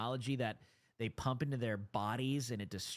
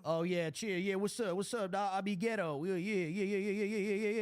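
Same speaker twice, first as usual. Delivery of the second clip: higher mocking street-slang voice; 7 semitones higher, faster than usual. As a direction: parody her fake slang.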